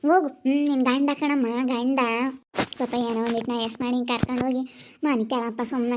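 elder woman telling the groom to take the bride home, low and quick; older woman advising. Acted. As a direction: hurry the girl along, resigned.

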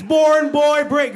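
high, loud exasperated repeat; retold shouting match; mock yelling. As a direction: yell the simple words at mom. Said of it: "You're just yelling at each other".

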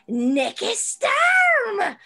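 put-on high, loud, drawn-out accented voice; hammed-up accented cry, stretching the name; Nikki Storm impression. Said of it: "That's a very good accent".

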